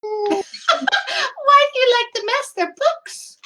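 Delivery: high-pitched, laughing disbelief; amused, squeaky-high objection; incredulous protest. Acted. Player protests to the silly ghost.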